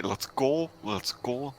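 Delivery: soft, urgent undertone; hushed urging. Acted. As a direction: hurry them along under your breath. Urging party quietly past dragon.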